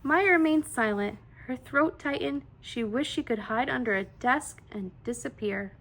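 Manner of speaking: plain reading voice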